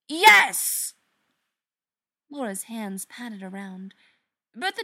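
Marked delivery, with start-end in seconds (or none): 0.1-0.9 high, very loud shout; furious yes. Acted. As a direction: scream it back at him. Sister screams her answer.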